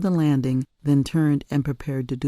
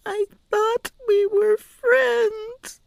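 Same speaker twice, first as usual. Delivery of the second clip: put-on high, slow tearful child; crying, hurt by the scolding; weeping; 3 dB louder, much slower than usual. A narrator's reading. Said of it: "wept Penelope".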